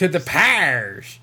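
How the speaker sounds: slow drawled hillbilly accent; hillbilly accent, stretched out slowly. Shown as a demonstration. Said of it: "my hillbilly accent comes out".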